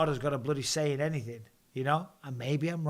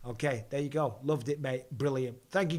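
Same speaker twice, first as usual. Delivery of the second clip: ordinary delivery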